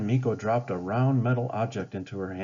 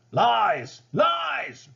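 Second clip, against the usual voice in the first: furious high shrieked denial, each word louder and higher; raging; 13 semitones higher, 5 dB louder than usual. A narrator's reading. Ratcliffe refusing to believe Smith, raging scream. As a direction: spit the word out in rage. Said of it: "Ratcliffe raged".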